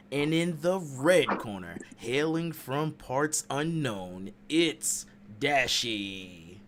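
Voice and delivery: slow booming ring introduction; boxing ring announcer. Pretend. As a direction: drag out the intro like fight night. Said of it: "Ring announcing is, is real".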